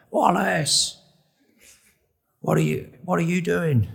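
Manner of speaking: put-on high drunken mate calling out a name; tipsy mates. Drunk friend greeting Wallace on the phone, merry high voice. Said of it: "They were well-oiled".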